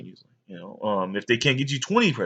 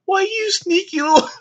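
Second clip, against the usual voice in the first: much higher, hammed-up mom voice; mom scolding; 5 dB louder than usual. Mom catching her son peeking, hammed-up high scold. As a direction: catch the sneaky kid, squeaky and over the top.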